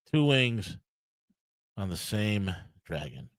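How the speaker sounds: low, soft and slowing to the end; subdued punchline. Real, not acted. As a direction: let it sink quietly, with pauses before "on the same dragon". Punchline repeated in resigned undertone.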